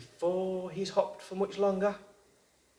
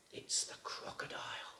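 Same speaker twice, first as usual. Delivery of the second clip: hushed, suspenseful whisper; 15 dB quieter than usual. A narrator's reading. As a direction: breathe it out like a secret. Suspense reveal of crocodile.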